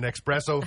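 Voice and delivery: ESPRESSO said as EX-presso; mispronounced espresso. Shown as a demonstration. Host punning through laughter, ESPRESSO said as EX-presso. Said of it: "is not espresso".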